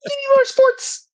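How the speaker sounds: high, slow mock brat whine; whiny spoiled brat. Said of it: "you're talking like a spoiled brat right now".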